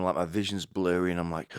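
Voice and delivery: ordinary talk, nothing marked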